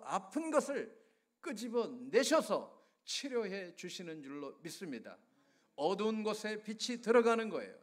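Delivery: real impassioned sermon delivery; fervent preaching. Real, not acted. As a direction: declare healing over the congregation with conviction. Preacher proclaims healing to congregation.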